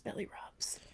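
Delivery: soft breathy whisper. Acted. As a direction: whisper it to yourself. Whispered echo of belly rubs.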